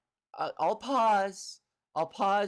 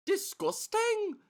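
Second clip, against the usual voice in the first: "Disgusting?" pitched much higher and drawn out slowly; mock incredulous; 12 semitones higher, slower than usual. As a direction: act scandalised, rising way up on "Disgusting?". Echoes own word as incredulous question.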